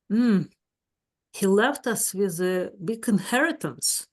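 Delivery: normal talking voice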